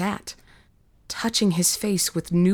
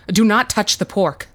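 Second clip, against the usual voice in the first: raised, higher forceful command; urgent warning; 4 semitones higher, 5 dB louder than usual. Acted. Bishop warns his men off the meat, urgent command. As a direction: warn them off urgently and loudly.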